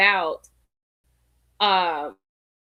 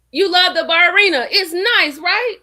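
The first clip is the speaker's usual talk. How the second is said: excited; 8 semitones higher, 7 dB louder than usual. Real, not acted. Excited shout-out to a viewer.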